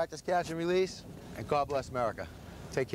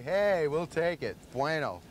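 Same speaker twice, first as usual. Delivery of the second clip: normal talking voice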